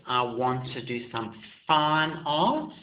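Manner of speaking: ordinary talk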